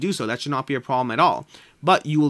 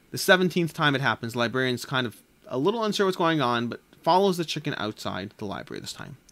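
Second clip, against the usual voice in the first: ordinary talk, nothing marked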